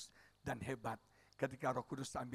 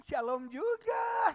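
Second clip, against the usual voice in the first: high, drawn-out, loud shalom; mock-overjoyed pastor's reply; beaming greeting; 8 dB louder, much slower than usual. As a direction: sing out the greeting in delight. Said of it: "saya bilang".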